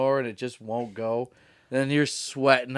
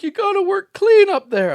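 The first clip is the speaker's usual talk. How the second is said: much higher, louder, insistent warning; anxious booker; 9 dB louder than usual. Retold or imitated. Booker warning the comic, mock high nervous voice. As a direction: urge it like a nervous boss. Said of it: "he's like".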